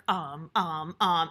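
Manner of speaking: mock stammer of a stunned boss; shocked stuttering. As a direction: stumble on repeated ums, as if thrown. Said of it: "and started stuttering".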